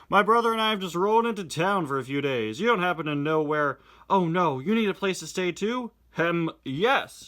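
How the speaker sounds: animated reading of the twins and Sally; comic dialogue read-aloud. Acted. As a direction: perform the comic lines with energy.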